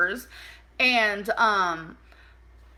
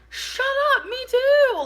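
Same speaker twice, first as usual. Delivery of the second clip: high, louder delighted squeal; excited squeal; 5 dB louder than usual. Retold or imitated. Both obsessed with cats, squealing excited agreement. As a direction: squeal like you found a twin.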